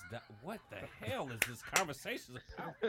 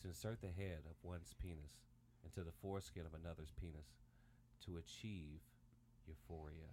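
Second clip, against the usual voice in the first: low, soft, measured read-out; proper definition read; 10 semitones lower, 13 dB quieter than usual. Shown as a demonstration. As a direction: read it out formally, low and quiet. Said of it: "read this as properly as I can".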